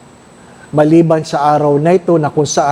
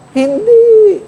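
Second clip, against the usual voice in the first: much higher and louder falsetto; squeaky mock shout; 5 dB louder than usual. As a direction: squeal the answer for laughs. Playful mock reply in sermon.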